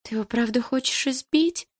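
тонко, жалобным голосом; выпроси ответ, жалобно и робко. A narrator's reading. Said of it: "жалобно спросила Малиновская". (жалобный высокий вопрос)

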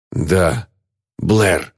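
breaking words apart for breath; halting, breath-starved answer; gasping for air. A narrator's reading. Bound man answers his friend, gasping. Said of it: "задыхаясь".

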